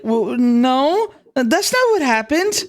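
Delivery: put-on high, childlike girl voice; young girl voice. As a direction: play the small girl protesting. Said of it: "Sally would be like".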